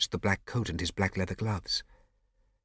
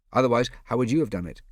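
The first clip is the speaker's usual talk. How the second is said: quick, louder snappish reply; sharp answer; 4 semitones higher, 7 dB louder, faster than usual. Acted. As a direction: snap it back fast. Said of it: "came Scipio's sharp answer".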